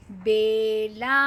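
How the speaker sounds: put-on each syllable drawn out for children; स्पष्ट धीमा उच्चारण; slow clear reading. Shown as a demonstration. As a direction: read it slowly and clearly, as a teacher. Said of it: "एक साथ पढ़ने की कोशिश करिए".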